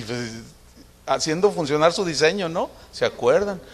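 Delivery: usual speaking voice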